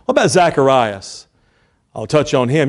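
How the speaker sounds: ordinary delivery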